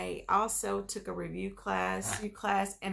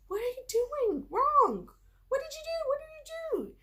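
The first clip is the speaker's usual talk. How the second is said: much higher, exasperated self-scolding; frustrated inner voice; 3 dB louder than usual. Retold or imitated. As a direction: scold yourself in a high, pinched voice. Frustrated self-talk after failing test.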